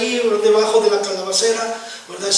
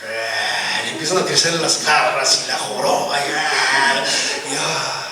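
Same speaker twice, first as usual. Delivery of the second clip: mock monster snarl; 5 semitones lower, slower than usual. Mock monster growing claws.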